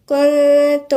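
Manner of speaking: كنتم with a hidden nun, held nasal hum; كنتم with ikhfa ghunna held; tajweed demo. Shown as a demonstration. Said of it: "هنقولها ازاي؟".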